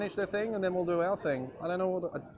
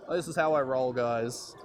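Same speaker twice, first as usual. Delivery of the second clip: normal talking voice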